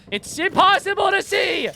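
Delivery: high drawn-out shout; frustrated shout. Real, not acted. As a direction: shout it slowly in exasperation. Cannot find enemy in fog.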